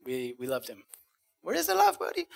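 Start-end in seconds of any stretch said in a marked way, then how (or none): 1.4-2.3 put-on high joking Mexican accent, buddy dropping away; the friend's catchphrase, high and jolly in a Mexican accent; remembering a dead friend's catchphrase in his Mexican accent. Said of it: "It was like his catchphrase".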